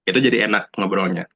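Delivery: usual speaking voice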